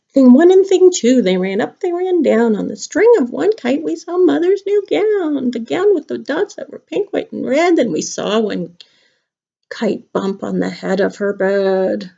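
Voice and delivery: put-on lilting, sing-song reading of the rhyme to children; storybook reading. Teacher reading Dr. Seuss rhymes to her class, sing-song. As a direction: bounce through the verse for little listeners. Reading Cat in the Hat aloud.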